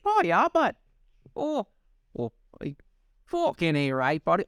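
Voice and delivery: a jokey Canadian "buddy" bit; Canadian accent. Pretend. S1 joins the bit with a hammed-up Canadian accent. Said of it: "our Canadian accent".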